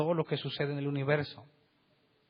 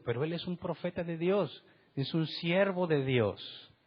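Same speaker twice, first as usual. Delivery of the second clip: ordinary talk, nothing marked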